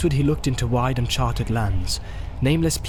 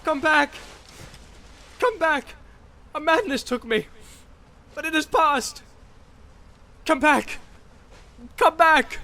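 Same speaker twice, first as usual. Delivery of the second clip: much higher, pleading calls; calling out. A narrator's reading. Boromir calling Frodo back, pleading. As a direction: beg him to return.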